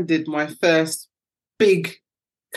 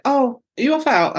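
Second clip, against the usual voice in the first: put-on kids asking; 7 semitones higher than usual. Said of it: "they were like".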